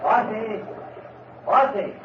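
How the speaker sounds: long call across the fields, pitched high. Acted. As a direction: call his name into the distance. Farmer calling for his cow.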